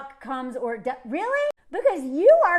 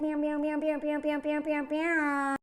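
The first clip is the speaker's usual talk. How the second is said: mock meowing; 4 dB quieter, slower than usual. Mocking celebrities' empty talk.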